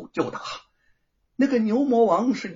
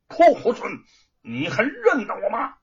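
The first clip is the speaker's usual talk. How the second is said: loud high jeering insult; taunting curse; 7 semitones higher, 4 dB louder than usual. A narrator's reading. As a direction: spit the insult at him. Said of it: "开言骂道".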